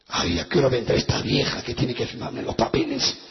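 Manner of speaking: a low, put-on grumbling voice; lightly voiced impatient thought; bored inner grumble. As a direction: mutter impatiently to yourself. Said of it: "Y yo estaba así".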